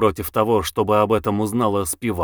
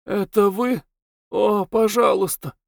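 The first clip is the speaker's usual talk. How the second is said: put-on much higher, frail girl's voice; weak voice; 9 semitones higher than usual. A narrator's reading. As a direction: call out weakly from the bed. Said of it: "прозвучал слабый голос".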